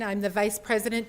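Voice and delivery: usual speaking voice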